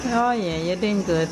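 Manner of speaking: usual speaking voice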